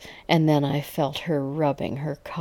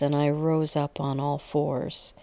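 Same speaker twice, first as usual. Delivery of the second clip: usual speaking voice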